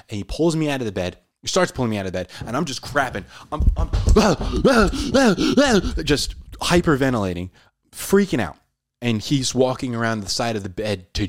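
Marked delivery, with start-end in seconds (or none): 3.9-6.0 nervous laugh. Retold or imitated. Describing hyperventilating panic.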